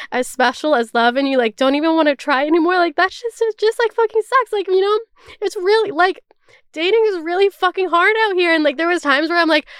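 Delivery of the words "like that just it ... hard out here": emotional. Real, not acted. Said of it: "I got kind of emotional".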